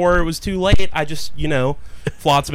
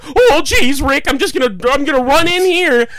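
dumb guy voice; 9 semitones higher, 5 dB louder than usual. Retold or imitated. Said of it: "It's like".

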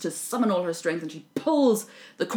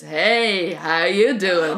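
slow, louder sleazy pickup line; flirty corpse; 8 dB louder, much slower than usual. Retold or imitated. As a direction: lay on the cheesy charm.

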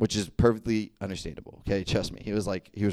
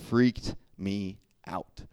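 slow, drawn out; much slower than usual. Real, not acted. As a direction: stretch it slowly, spooked. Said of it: "That last part".

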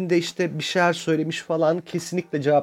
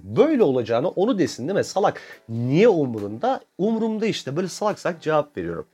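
usual speaking voice

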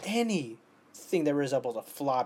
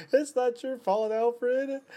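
high-pitched, louder weepy cry; mock tearful wail; 11 semitones higher, 5 dB louder, slower than usual. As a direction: wail it as if crying along with him. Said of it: "I start crying with him".

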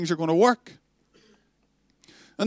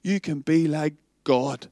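quieter, coaxing hint of a serpent; serpent whisper; 5 semitones lower, 5 dB quieter than usual. Serpent whispering to Adam and Eve, soft and coaxing. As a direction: tempt them softly, low. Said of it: "a wee whisper from the serpent".

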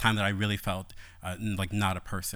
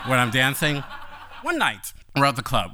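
normal talking voice

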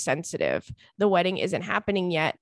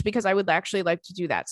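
ordinary delivery

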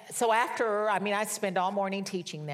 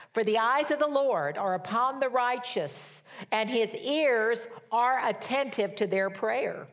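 higher-pitched, ringing preacher's proclamation; emphatic scripture reading; 4 semitones higher, 4 dB louder than usual. Acted. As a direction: proclaim the verse with punched key words. Reads scripture emphatically to congregation.

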